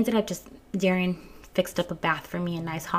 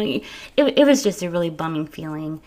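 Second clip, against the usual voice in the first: normal talking voice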